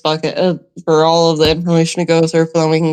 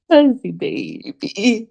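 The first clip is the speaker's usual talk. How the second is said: cooing; 4 semitones higher, much slower than usual. Acted. Cooing to a picked-up creature.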